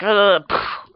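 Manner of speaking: short, high, loud imitated "ah"; mock scream. Man blowing up on the screen, mock scream. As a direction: burst out with a mock death cry.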